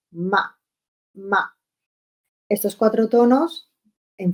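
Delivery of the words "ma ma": short falling MA; fourth tone, as if angry. Shown as a demonstration. Teacher demonstrating the fourth tone, curt descending "ma". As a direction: drop "ma" abruptly, like a cross word. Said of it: "como si estuviéramos enfadados".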